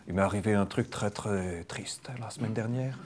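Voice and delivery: a lightly put-on Belgian accent, half laughing; hammed-up Belgian accent, barely held; mock Belgian accent. Shown as a demonstration. Hypnosis trainer jokes to the room, mock Belgian accent. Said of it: "je vais pas faire l'accent belge".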